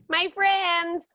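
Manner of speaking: put-on high, loud little-kid voice for my friends; sweet naive kid. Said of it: "a sweet kid who's just like".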